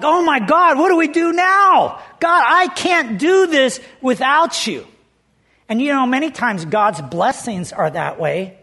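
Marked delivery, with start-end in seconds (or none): 0.0-4.9 high, loud panicked plea; scared. Retold or imitated. Pastor retelling his plea to God after the first service, scared. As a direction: cry out in alarm, stretching the last words. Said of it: "Man, I was scared".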